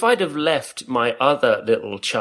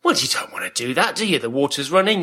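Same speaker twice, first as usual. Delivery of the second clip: normal talking voice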